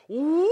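high loud yelp; excited yelp. Retold or imitated. Teen spinning the car, yelping loud. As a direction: let out a wild whoop.

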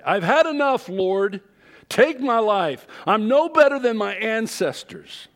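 high, loud despairing cry to God; anguished cry. A narrator's reading. Elijah crying out to God, high and loud in despair. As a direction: cry out that you've had enough. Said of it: "was his cry".

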